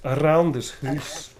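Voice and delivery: "Around" with a rolled R, "hoose" for house; slow Scottish "Around this hoose". Shown as a demonstration. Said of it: "rolling the R's really helps".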